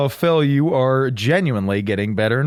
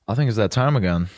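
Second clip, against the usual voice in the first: low, hushed, portentous build-up; mock ominous announcement; 9 semitones lower, faster than usual. Host teasing co-host that segment time has come, in a low hushed voice. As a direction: drop the voice low to tease the segment.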